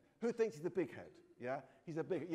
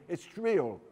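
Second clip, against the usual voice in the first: louder fierce insistence; fierce reply; 4 semitones higher, 13 dB louder than usual. A narrator's reading. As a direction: insist hotly, with a raised voice. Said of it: "I replied fiercely".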